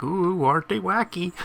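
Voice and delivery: mock wacky shtick. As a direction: send up a wacky sitcom gag. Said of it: "the whole shtick is like".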